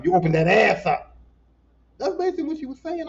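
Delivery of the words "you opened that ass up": loud, angry accusation; accusing wife. Retold or imitated. Wife confronting husband over unopened tackle box, accusing. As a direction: hit it loud like a wife calling out her husband.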